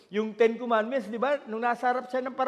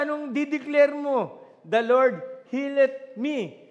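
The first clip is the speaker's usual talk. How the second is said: loud preaching; 3 semitones higher, 6 dB louder than usual. Acted. Declarations urged loudly.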